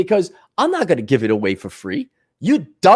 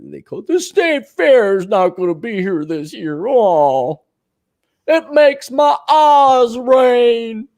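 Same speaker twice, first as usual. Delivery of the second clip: mock tearful wail, rising at the end; hammed-up lament stretched into a slow cry; weepy old-timer; 5 semitones higher, slower than usual. Old-timer mourning the state fair, mock weepy wail. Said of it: "these old timers and these old farts".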